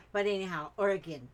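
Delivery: usual speaking voice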